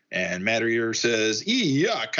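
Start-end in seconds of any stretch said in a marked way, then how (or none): 1.5-2.2 drawn-out, high-pitched yuck; mock disgust. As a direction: recoil in hammed-up revulsion. Character recoils after near kiss.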